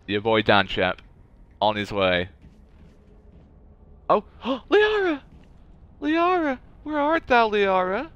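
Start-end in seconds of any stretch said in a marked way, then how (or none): 4.1-8.1 high, dramatic cry of a name; theatrical calling out. Pretend. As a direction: call out like a stage actor. Calling out for the trapped character.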